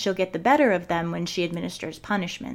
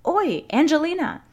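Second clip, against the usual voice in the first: high, loud call to someone far off; calling across a room; 9 semitones higher, 5 dB louder than usual. Acted. Fred calling to Angelina across the common room, loud call. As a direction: shout her name across the common room. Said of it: "called across the common room".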